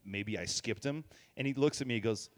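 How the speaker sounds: normal talking voice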